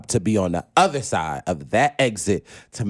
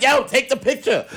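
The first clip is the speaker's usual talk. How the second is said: calling out; 14 semitones higher than usual. Retold or imitated. Telling his friends to photograph him hugging Oprah, calling out. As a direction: yell to friends to snap the photo.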